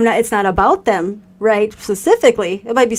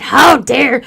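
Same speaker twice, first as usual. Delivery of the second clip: indignant; 4 semitones higher, 6 dB louder, slower than usual. Retold or imitated. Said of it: "she interrupted".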